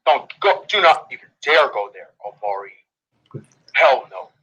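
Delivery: normal talking voice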